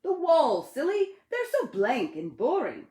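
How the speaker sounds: high, loud playful voice; teasing Ted. Acted. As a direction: tease the slow friend.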